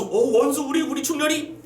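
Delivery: high, stammering surprise; astonished recognition. Acted. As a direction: act amazed at who the rescuer is. Emperor recognizes his rescuer.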